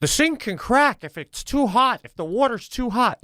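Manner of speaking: high, fretful imitation of the wife; mimicking fussy wife. Imitating his wife fretting about the sink, high and fussy. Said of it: "she gets so anal with, like".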